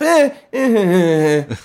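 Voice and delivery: halting, uneasy little laugh; strange hesitant laugh. Retold or imitated. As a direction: imitate a nervous, hesitant chuckle. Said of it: "really strange, like, hesitant laugh".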